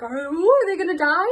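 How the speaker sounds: hammed-up suspense; mock dramatic suspense. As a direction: gasp it like a soap teaser. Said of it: "being like".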